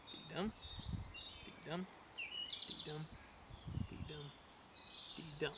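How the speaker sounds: soft, separate dee-DUMs with a pause after each; iambic beat. Shown as a demonstration. Teacher marks each foot, quiet spaced dee-DUM stress. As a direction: count out dee-dum softly, weight on dum. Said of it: "dee-dum rhythm".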